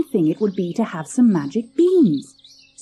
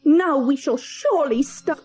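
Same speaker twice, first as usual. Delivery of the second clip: higher, scolding, cut off; angry mother; 6 semitones higher than usual. Acted. Mother berates Jack about starving, higher angry voice. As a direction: snap it out before breaking off. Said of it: "In her anger".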